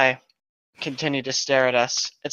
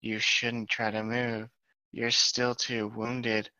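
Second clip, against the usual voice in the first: softer, slow caring advice; Jenna's soft voice; 3 semitones lower, 9 dB quieter, slower than usual. Acted. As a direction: gently tell him to stay still. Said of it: "that's Jenna".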